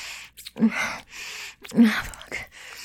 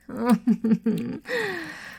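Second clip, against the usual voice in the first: teasing chuckle; 5 semitones higher than usual. Acted. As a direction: chuckle knowingly at a lover. Amused by the partner's reaction.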